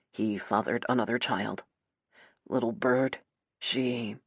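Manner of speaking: low, soft, halting tenderness; gentle. A narrator's reading. Nate tells her of another child, gentle. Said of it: "Nate says gently".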